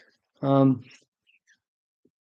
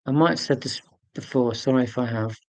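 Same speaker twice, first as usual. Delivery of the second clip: ordinary talk, nothing marked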